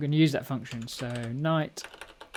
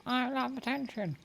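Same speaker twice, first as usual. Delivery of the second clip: much higher, softer cat voice; voicing the cat; 11 semitones higher, 5 dB quieter than usual. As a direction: speak for the cat as if she were talking. Speaking for the cat.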